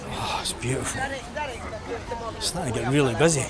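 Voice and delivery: usual speaking voice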